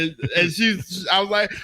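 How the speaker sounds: ordinary delivery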